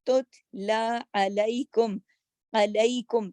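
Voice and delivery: slow, careful recitation of Tatla علیکم; slow Qur'anic recitation. Shown as a demonstration. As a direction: recite each letter clearly. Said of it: "جما کے".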